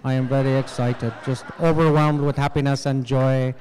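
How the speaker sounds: low, flat monotone announcing joy; deadpan excitement. Retold or imitated. A Pakistani dryly saying he's thrilled, deadpan. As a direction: claim excitement with no excitement at all. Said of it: "not as excitable".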